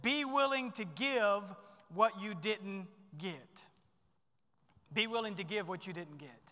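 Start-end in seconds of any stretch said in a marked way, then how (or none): 0.0-3.6 slow and deliberate, each word weighted; dictating a point slowly; slow emphatic key point. Shown as a demonstration. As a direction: spell it out slowly so they can write it down. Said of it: "Write these down".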